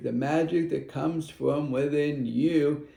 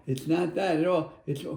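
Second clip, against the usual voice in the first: ordinary talk, nothing marked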